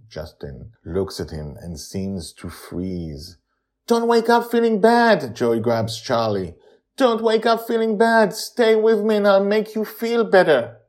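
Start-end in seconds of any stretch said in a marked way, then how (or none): 3.9-5.4 put-on high, loud girl's plea; a little girl urgently pleading. A narrator's reading. 7.0-10.8 put-on high, loud girl's plea; a little girl urgently pleading. A narrator's reading.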